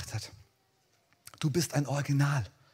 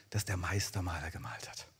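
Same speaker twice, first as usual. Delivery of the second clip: a sudden awed whisper; hushed whisper; 9 semitones lower, 8 dB quieter than usual. Real, not acted. Preacher whispers to the congregation about the painting. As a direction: breathe it out, no voice.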